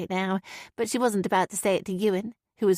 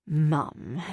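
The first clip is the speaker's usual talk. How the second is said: gentle; 5 semitones lower than usual. Acted. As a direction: ease into it gently, lower. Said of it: "Graham began gently".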